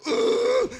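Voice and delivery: a high grunt for the coffee nozzle; nozzle groan. Retold or imitated. Mocking the Starbucks nozzle, a high grunt. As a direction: squeeze out a high machine grunt. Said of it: "the nozzle goes".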